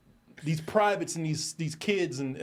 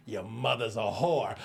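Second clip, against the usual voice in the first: put-on drunk insult; 5 semitones lower than usual. Drunk insults calm guy.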